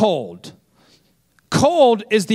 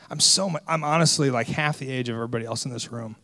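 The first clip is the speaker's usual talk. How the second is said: hushed, fast muttering to himself; quiet self-talk; 7 semitones lower, 7 dB quieter, faster than usual. Retold or imitated. Pastor among elders, muttering his doubt quietly. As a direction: let it slip out under the breath. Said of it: "I say".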